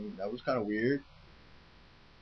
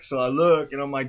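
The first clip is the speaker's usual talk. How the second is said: normal talking voice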